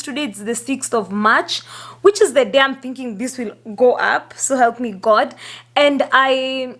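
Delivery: ordinary talk, nothing marked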